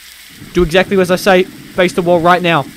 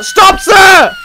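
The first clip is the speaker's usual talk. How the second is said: loud, high shout at the suspect; yelling from the car; 12 semitones higher, 8 dB louder than usual. Pretend. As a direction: yell it out of the car window. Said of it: "I'm yelling in the car".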